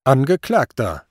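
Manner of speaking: stern summons to the accused; judge's formal address. A narrator's reading. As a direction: call out sharply from the bench. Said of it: "erhob er seine Stimme".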